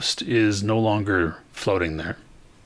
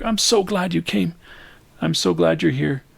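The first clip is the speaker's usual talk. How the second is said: high, louder gushing relief; 9 semitones higher, 4 dB louder than usual. Acted. Karen hugs Elliot, gushing high and louder. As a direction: pour it out, overjoyed and sorry. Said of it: "she's just gushing and going on".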